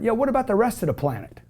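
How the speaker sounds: ordinary talk, nothing marked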